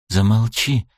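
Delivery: plain reading voice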